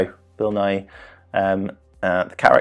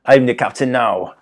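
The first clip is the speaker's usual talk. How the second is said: higher, punchier mock Jamaican accent; 3 semitones higher, 4 dB louder than usual. Host repeating a film pirate's line, mock Jamaican accent. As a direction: put on a hammy Jamaican accent. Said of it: "I don't know why I've done it Jamaican".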